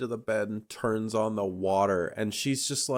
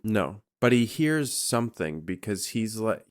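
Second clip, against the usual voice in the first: ordinary talk, nothing marked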